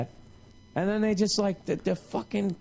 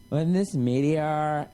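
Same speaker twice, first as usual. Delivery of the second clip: dramatic reality narration; 5 semitones lower than usual. Retold or imitated. Mimicking show's dramatic narration.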